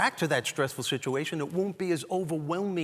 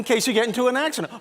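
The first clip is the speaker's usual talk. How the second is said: mother's reasoning; 10 semitones higher, 6 dB louder than usual. Retold or imitated. Mom's reasoning, acted.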